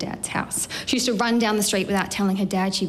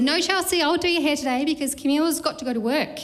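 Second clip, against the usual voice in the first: higher-pitched mock mum, lightly voiced; mum's refusal; 7 semitones higher than usual. Mum tells little Chelsea no hair today, lightly imitated higher voice. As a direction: lift the voice into a retold motherly refusal.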